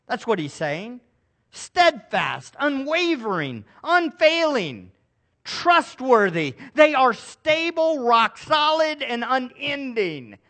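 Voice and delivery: loud, rising sermon proclamation; booming preacher's declaration; preaching at full force. Acted. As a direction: proclaim the Lord's authority from the pulpit. Preacher lists attributes of Scripture.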